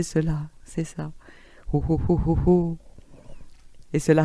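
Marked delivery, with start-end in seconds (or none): 1.7-2.8 amplified ho ho. Shown as a demonstration. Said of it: "amplifiez, amplifiez".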